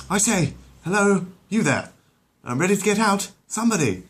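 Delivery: high, loud posh call for help; Stewie calling. Acted. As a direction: summon the servants from the water.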